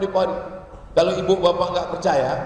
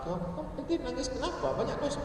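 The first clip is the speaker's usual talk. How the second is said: high, soft mock inquiry; teasing questioner; 7 semitones higher, 16 dB quieter, faster than usual. Congregant asks neighbour why he cried, high soft voice. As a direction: ask it small and high, like a nosy neighbour. Acted chat between tearful congregants.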